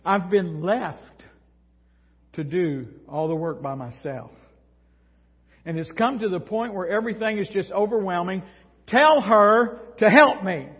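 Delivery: rising from weary to a loud demand; mock overwhelmed complaint. Martha begging Jesus to make Mary help, building to loud. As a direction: pour out the grievance, then snap the last order. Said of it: "She feels alone".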